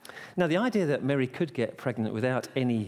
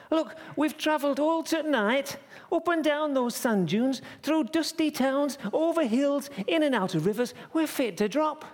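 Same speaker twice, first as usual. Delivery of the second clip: indignant plea. Acted. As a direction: pile up the hardships in a high shout. Joseph pleading loudly with innkeeper.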